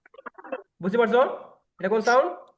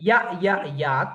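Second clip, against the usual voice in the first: Ya, ya, yak drawn out slowly, each ya separate; slow phonics drill; much slower than usual. Shown as a demonstration. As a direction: chant Ya ya yak slowly for kids to copy. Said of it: "The sound is ya".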